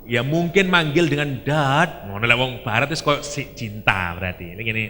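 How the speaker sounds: ordinary talk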